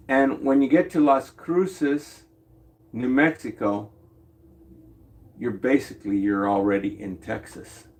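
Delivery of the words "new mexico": ordinary delivery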